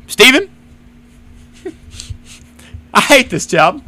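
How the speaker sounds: exasperated venting. Retold or imitated. Venting at manager, retold.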